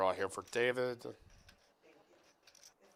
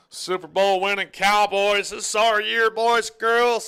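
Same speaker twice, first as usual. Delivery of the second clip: much higher, louder mock fan cheer; mock hype; 12 semitones higher, 13 dB louder than usual.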